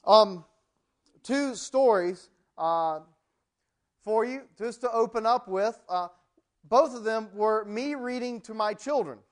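ordinary delivery

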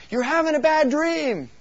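loud, high, slow call across the cabin; yelling. Retold or imitated. Camper yells to the dreaming girl, loud high call. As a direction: shout it to wake someone from a nightmare. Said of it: "somebody was yelling".

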